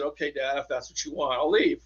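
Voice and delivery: soft, choked-up retelling of a goodbye; quietly crying son giving in to his dying father; tearful and quiet. Retold or imitated. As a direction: say it through tears, barely above a hush. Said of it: "So I started crying".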